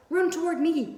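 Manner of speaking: high, loud honking yell; gander yelling. A narrator's reading. Gander yelling to Wilbur during the chase. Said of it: "yelled the gander".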